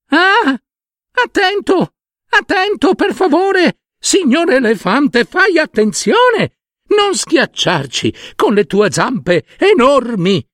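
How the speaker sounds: very high, tiny, pleading cries; tiny ant voices. Acted. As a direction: squeak up at a giant begging not to be crushed. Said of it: "sentì delle vocine, piccine, piccine, urlare". (vocine piccine di formiche che implorano l'elefante)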